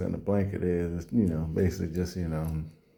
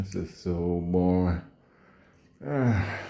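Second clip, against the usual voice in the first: normal talking voice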